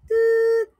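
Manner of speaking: high, loud censor bleep "toot"; bleep sound. Acted. Self-censoring a word with bleep. (malakas at matinis na "toot" na pang-censor)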